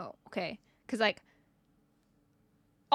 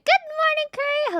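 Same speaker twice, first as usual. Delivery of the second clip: good morning tossed up high and bright; high cheery greeting; 5 dB louder than usual. Real, not acted. Streamer calling a good morning to a chatter, high and cheery. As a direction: chirp a hello up high.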